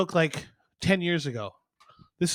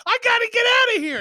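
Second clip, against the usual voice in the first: high, loud panicked yelp rising to a squeal; panicked explorer; 6 dB louder than usual. Acted. Explorer panicking at a room of lions, high and loud. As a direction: freak out at the lions.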